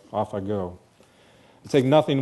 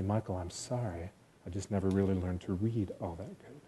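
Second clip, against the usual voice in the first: a slower, quieter retelling of her apology; slower, softer apology; 3 semitones lower, 9 dB quieter than usual. Retold or imitated. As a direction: let the apology hang, slow and hushed. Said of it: "She's apologizing to me".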